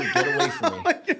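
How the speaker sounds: high, slow, laughing whine; mock scared thief. Retelling how thieves react to the superhero, high mock whine. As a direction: play the thief squirming away from the hero.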